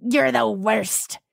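drawn-out, very slow mock taunt; slow put-on bully voice; imitating brother. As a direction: draw out every word like a menacing brother. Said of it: "and he'd say".

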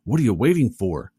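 ordinary delivery, nothing marked

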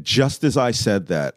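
ordinary talk, nothing marked

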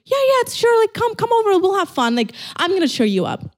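imitating her over-eager self inviting a friend; eager reassurance. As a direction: gush to win the friend over. Voicing herself eagerly inviting.

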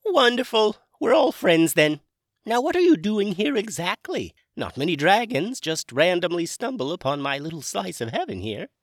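high, loud dragon character voice; Hamish dragon voice. Acted. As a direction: play a chirpy, high-pitched dragon wizard. Hamish speaks.

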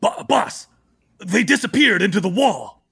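high, confused guard voice; high baffled henchman; dim guard. Acted. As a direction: squeak it out, baffled. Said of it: "He says".

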